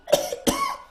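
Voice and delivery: hacking choking cough. Acted. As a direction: cough like something went down wrong. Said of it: "went down the wrong hole".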